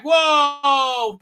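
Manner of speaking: loud, high hammed-up yell; mock alarm cry. Acting out the force shove, a high mock yell. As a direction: cry out as if flung backwards. Acts out being thrown back.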